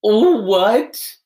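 high, loud disbelief; shocked. Real, not acted. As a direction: blurt it out in shock. Shocked at preferring high school.

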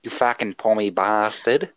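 mock outraged Aussie bloke; a hint of a swearing Australian; Australian accent. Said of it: "he is, in fact, from Australia".

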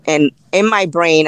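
usual speaking voice

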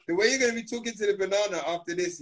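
ordinary talk, nothing marked

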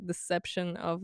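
normal talking voice

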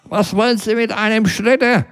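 put-on a half-deaf elderly passer-by, puzzled; old man. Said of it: "Ein älterer Herr, der nicht mehr so gut hörte, meinte".

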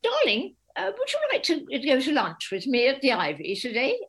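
imitating a posh girlfriend inviting her to lunch; posh darling voice. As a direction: put on her friend's plummy lunch voice. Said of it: "she talks like this".

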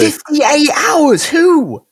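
high, drawn-out disbelief; incredulous. Real, not acted. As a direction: stretch it out in real astonishment. Cannot believe the kiss length.

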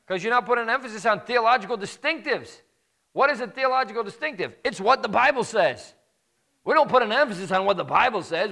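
high, loud pulpit preaching at full force; rising fire-and-brimstone sermon voice; fiery preaching. Acted. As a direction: preach it hard to the congregation.

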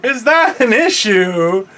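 slow, high, put-on whiny voice; mock her baffled question, drawn out; imitating friend. Teasing Crystal at the steering wheel, mock slow and high. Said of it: "she's like".

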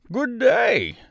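high, loud scarecrow greeting; lightly husky, hearty hello. A narrator's reading. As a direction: call it out high in a husky character voice. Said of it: "in a rather husky voice".

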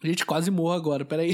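hoarse after choking. Real, not acted. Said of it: "Eu me engasguei".

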